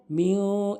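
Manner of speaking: মিউ held as a nasal hum; ikhfa. Shown as a demonstration. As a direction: draw মিউ into the nose. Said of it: "নাকের ভিতরে নেবেন". (মিউ নাকের ভিতরে নিয়ে)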